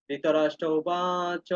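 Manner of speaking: slow, drawn-out Sanskrit reading of Dhritarashtra Ubach; slow recitation. Shown as a demonstration. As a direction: read each syllable of Dhritarashtra Ubach slowly. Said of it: "এটা উচ্চারণ করবে কি?". (ধীরে ধীরে ধৃতরাষ্ট্র উবাচ পাঠ)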